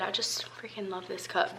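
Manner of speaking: breathy whisper to the camera. Real, not acted. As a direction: murmur it under the breath, almost no voice. Sipping coffee, whispering to camera.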